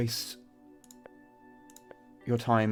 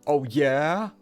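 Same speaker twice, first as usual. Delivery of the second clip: high taunting challenge; 3 semitones higher, 6 dB louder than usual. Acted. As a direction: goad them, rising. Mock back-and-forth taunt.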